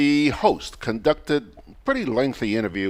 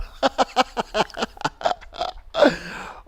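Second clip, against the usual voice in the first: long, high, breathy cackling laugh; real hearty laugh; 11 semitones higher than usual. Real, not acted. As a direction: laugh helplessly at the joke. Laughing at a listener's joke.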